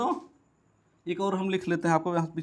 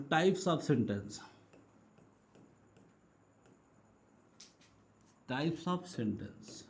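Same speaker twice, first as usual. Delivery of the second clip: very slow, low and softer; slow dictation; 4 semitones lower, 6 dB quieter, much slower than usual. Real, not acted. Teacher writing the new topic heading, slow dictation. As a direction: draw out the heading as you write. Writes the heading while saying it.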